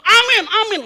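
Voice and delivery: mock premature amens, high and loud; over-eager amen. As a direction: imitate jumping in with repeated loud, high amens. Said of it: "aminnya kecepetan juga jadi masalah".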